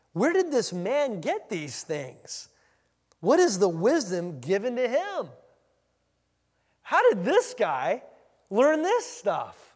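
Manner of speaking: loud, drawn-out disbelieving questions; incredulous indignant reading. Acted. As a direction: read it as scornful, heavily stressed questions. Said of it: "That's how it probably should read".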